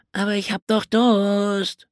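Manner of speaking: put-on high whiny child voice; wailing boy. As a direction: whine it like a thirsty little boy. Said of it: "heulte Stanley".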